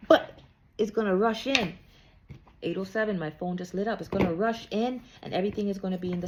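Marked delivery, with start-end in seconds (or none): 0.1-1.7 sudden loud burst. Real, not acted. Sudden reveal after whisper.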